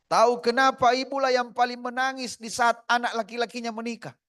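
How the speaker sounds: high, loud pulpit cry; full-force preaching. Acted. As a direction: preach it out to the hall. Preacher raises voice.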